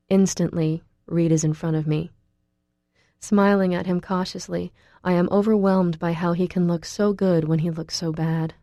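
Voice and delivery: ordinary delivery, nothing marked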